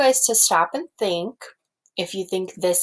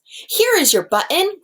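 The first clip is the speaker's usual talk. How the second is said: higher and louder excited cry; cried out; 11 semitones higher, 4 dB louder than usual. Acted. Frog crying out to Toad about a found button. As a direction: call out gladly, as if finding it. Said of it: "cried Frog".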